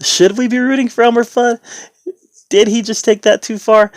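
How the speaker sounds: high-pitched, put-on questioning; mock doubting questions. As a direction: wonder aloud in a comically high voice. Mock doubting questions about Bugs.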